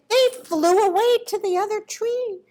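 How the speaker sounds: ordinary delivery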